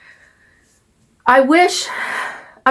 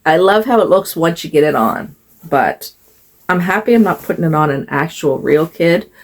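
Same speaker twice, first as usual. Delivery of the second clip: normal talking voice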